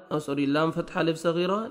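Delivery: ordinary delivery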